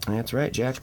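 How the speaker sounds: ordinary talk, nothing marked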